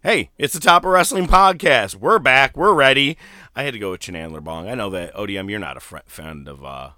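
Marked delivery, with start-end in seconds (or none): 0.0-3.2 loud, high energetic welcome; hyped intro. Acted. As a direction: pump up the crowd.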